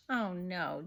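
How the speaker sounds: usual speaking voice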